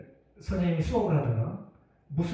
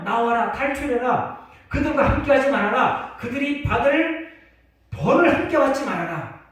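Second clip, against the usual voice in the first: preaching at full force; 8 semitones higher, 6 dB louder than usual. Acted. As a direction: cry out the command to flee Babylon. Preaching the scripture call.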